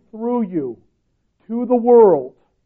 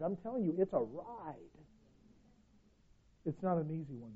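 ordinary delivery

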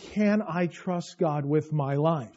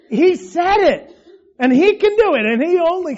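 excited proclaiming; 9 semitones higher, 8 dB louder than usual. Real, not acted. Preacher to congregation, about God's power, excited and much louder. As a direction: ring it out with delight.

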